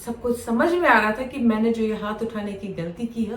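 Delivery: usual speaking voice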